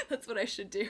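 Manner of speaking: ordinary talk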